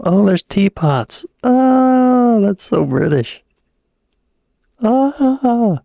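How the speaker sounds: high, loud delighted exclamations; thrilled squeals at teapot yarn art. Real, not acted. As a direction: gush over the photos. Reacting to yarn bomb photos.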